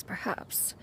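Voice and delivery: a soft, fading whisper; whispered doubt. Acted. Cloud Retainer doubting her own idea, whispered. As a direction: breathe the word out, unsure.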